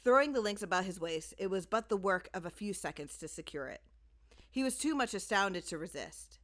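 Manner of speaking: ordinary delivery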